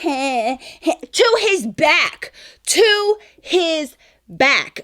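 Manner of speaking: "To his back" drawn out very slowly, pitched high; outraged emphasis. Real, not acted. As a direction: stretch every word of "To his back" in disbelief. Outraged repetition of what she saw.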